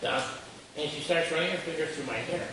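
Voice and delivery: ordinary delivery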